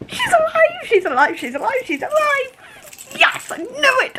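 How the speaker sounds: squealing high, thrilled chant; excited cheer. Real, not acted. Celebrating to viewers that Valkyrie lives, excited squeal. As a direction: celebrate like your favourite came back.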